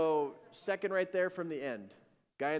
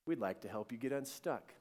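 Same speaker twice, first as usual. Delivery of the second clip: low, soft, eerily calm offer; creepy stranger; 5 semitones lower, 7 dB quieter than usual. Retold or imitated. Stranger offers to help stuck dad, creepy and quiet. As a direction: imitate a strange rescuer speaking quietly. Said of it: "That was the creepiest conversation".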